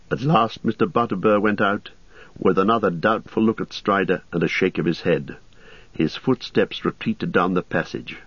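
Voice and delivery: plain reading voice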